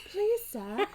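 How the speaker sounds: high, laughing British accent; squeaky hammed-up British plea; awful British accent. Shown as a demonstration. Mother demonstrating the line in an awful British accent. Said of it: "in my awful accent".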